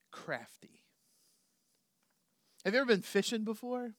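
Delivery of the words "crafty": hushed, breathy crafty; whispered. Real, not acted. Sermon point said hushed.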